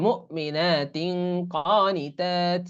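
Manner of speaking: মুমিনাতিন very slow with nasal তিং before কা; slow recitation. Shown as a demonstration. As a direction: stretch each syllable. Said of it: "এরকম উচ্চারণ হবে।". (ধীরে তিলাওয়াত, গুন্নাসহ)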